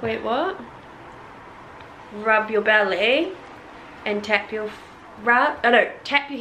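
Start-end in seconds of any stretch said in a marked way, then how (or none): none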